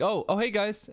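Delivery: high, breezy mock greeting; casual passer-by. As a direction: imitate someone casually dropping by. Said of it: "drive by".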